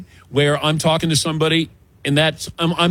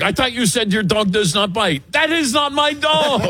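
hammed-up movie detective, last line much higher and shouted through a laugh; Pink Panther inspector; 9 semitones higher than usual. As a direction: ham up the inspector's indignant punchline. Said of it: "the scene in Pink Panther".

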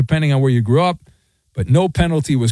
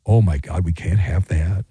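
mock horror; 10 semitones lower than usual. Host mocking the outraged media, mock hushed horror. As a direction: play the fainting critic, hushed.

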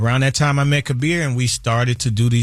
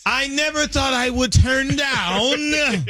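high, drawn-out playful shout; hype shout; 11 semitones higher, slower than usual. Acted. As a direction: yell it slowly like a party hype man. Comic yelled opener in his catchphrase style.

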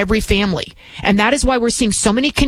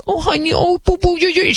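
put-on high, sugary baby-talk of a doting mother; cooing mom; 9 semitones higher than usual. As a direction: coo at the child like petting a kitty. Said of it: "and the mom was like".

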